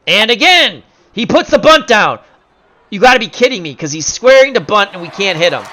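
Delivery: high, loud, protesting call; exasperated disbelief. Pretend. Announcer can't believe they cannot hit him, loudly exasperated.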